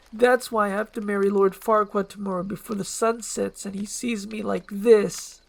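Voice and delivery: lightly voiced, emphatic princess telling her woe; distressed princess. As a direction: lay out your curse with rising feeling. Princess confesses curse, near tears.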